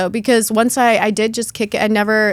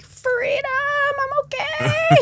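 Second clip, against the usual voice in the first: very high, stretched-out hammed-up rejoicing; mock triumphant elation; 3 dB louder than usual. Speaker mocks feeling okay after quitting Klonopin, high exultant cry. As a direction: proclaim it like a euphoric, newly freed convert. Acts out her naive early elation.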